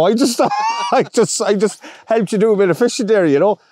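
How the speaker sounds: high-pitched, laughing modesty; laughing protest. Retold or imitated. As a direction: retell your protest through laughter.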